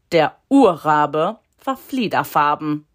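ordinary delivery, nothing marked

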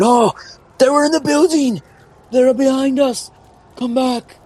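high-pitched cries of alarm; alarmed shouting. Acted. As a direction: call out high to warn the others. Panicked individuals outside calling out.

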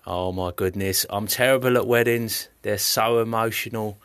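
ordinary talk

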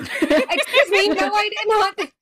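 high, loud, drawn-out denial; mock indignation. Real, not acted. Co-host denying friends' teasing accusation about the podcast name, indignant.

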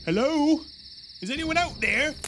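loud nervous call into the woods. Acted. Rodney calling toward the tree line, loud and uneasy.

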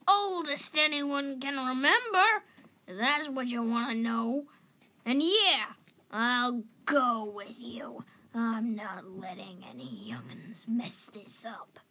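crusty, determined, settling into a grumble; feisty old cat. A narrator's reading. Old cat agreeing to join youngsters, feisty and croaky.

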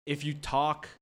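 ordinary talk

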